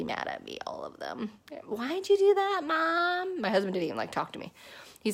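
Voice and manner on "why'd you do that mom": higher mock kid voice, protesting; kids whining at mom. The upset kids ask mom why, high whiny kid voice.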